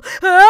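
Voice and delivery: huge high achoo; explosive sneeze; loud sneeze. A narrator's reading. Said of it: "one final extra special".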